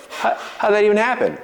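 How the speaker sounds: high, puzzled mock surprise. Voicing a surprised newcomer.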